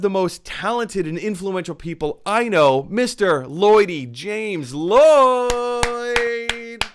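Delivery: host introducing the guest, drawn-out announcer voice; announcer intro. Acted. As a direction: boom the name like a ring announcer.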